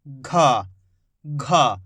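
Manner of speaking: घा said low and breathy, voiced g with a strong puff; heavy gh sound. Shown as a demonstration. Teacher showing students the heavy घा sound. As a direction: drop low and push breath on घा. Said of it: "इसकी ध्वनि कैसे निकल रही है?".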